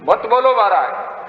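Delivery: high-pitched protesting voice; mimicked objector. Objectors telling the preacher not to speak openly, high mimicked protest.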